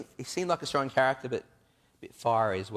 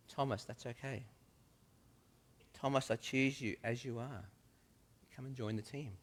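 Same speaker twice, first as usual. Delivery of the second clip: much softer, gentle comfort; soft reassurance; 10 dB quieter than usual. Acted. Jesus reassuring a doubting Thomas, soft and calm. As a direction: hush his worry gently.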